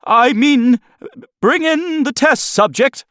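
high-pitched flustered assistant; nervous gulp. Acted. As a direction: falter anxiously before the boss. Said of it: "with a nervous gulp".

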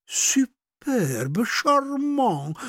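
lightly affected French, rising high on charmant; a hint of affected French; bad French. A narrator's reading. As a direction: ladies showing off their poor French. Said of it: "breventily francouzsky".

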